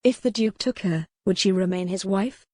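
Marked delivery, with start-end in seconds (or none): none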